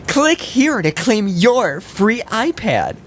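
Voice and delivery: high, slow cheesy prize announcer; scam pop-up ad. Pretend. Mocking a pop-up ad for the podcast friends, high and slow. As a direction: sell the fake prize with a big fake grin. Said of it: "the way you said that".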